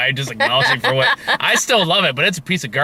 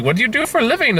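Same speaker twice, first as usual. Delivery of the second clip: put-on Indian accent; 9 semitones higher, faster than usual. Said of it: "woman with a thick Indian accent".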